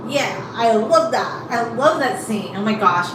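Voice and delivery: usual speaking voice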